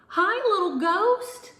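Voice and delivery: high, loud, bursting greeting; very excited. A narrator's reading. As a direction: squeal it out with joy. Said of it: "Luna said very excitedly".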